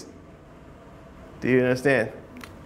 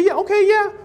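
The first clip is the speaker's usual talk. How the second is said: going along; 11 semitones higher than usual. Retold or imitated. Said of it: "you can say".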